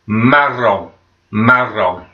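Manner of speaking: marron with a rolled French R and nasal end. Shown as a demonstration. Teacher modelling marron for pupils, rolling the R. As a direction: roll the R in marron. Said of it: "you've got to roll those R's".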